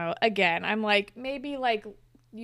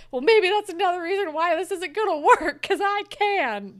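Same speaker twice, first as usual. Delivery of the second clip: high, loud mock-angry comeback; raised-voice imitation of a quarrel; retold mad; 8 semitones higher, 8 dB louder than usual. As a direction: fire back in a hammed-up argument, dragging the last words. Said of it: "And everyone's mad".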